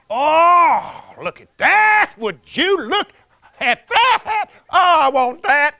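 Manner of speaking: high, loud, gasping wonder; covetous excitement. Retold or imitated. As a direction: gawk and crave out loud. Lucifer covets the woman.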